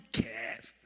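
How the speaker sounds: exaggerated American "Cast.", flat a, breathed out; overdone American cast. Shown as a demonstration. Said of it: "I'm ha-, overdoing it".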